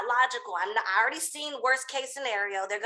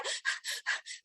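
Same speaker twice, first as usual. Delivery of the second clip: soft, voiceless puffs of panic; panicked panting; 10 dB quieter than usual. Retold or imitated. Climber unable to take the step, panicked panting. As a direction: act out hyperventilating softly.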